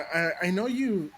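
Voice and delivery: ordinary talk, nothing marked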